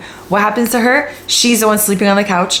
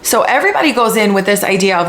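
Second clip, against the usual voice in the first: ordinary delivery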